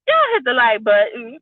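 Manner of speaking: ordinary talk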